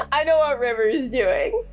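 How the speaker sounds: ordinary talk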